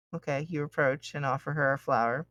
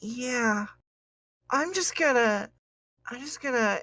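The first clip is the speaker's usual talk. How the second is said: high, shaky NPC voice; lightly voiced timid girl; nervous young woman; 8 semitones higher than usual.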